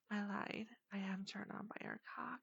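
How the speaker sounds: hushed breathy confession; whispering. Acted.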